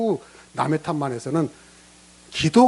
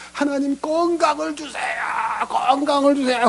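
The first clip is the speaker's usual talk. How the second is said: mock shouted plea; 9 semitones higher than usual. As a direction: wail the plea up high like a spoiled believer. Mock shouted prayer for health.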